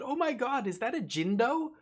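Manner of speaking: high, gushing stranger's squeal; excited stranger. Retold or imitated. Dog owner spotting a Jindo, high excited squeal. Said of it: "Another person came up and said".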